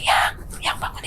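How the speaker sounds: soft unvoiced whisper to wake someone; urgent whisper. Waking her husband at night, whispering urgently. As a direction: whisper so nobody else hears.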